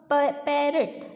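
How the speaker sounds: "प-, पैरट" with the opening p sounded alone first; teacher isolating the p in "पैरट"; first sound isolated. Shown as a demonstration. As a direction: say a short "प-", then the whole word "पैरट". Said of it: "किसका sound सुनाई दे रहा है".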